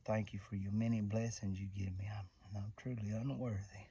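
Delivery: quiet prayer of thanks. Real, not acted. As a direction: pray softly over the deer.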